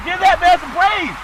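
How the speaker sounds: high, loud hype shout; shouted praise. Real, not acted. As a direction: belt out the catchphrase like a hype man.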